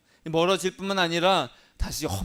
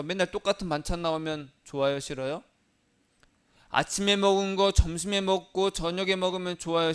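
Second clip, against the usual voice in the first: normal talking voice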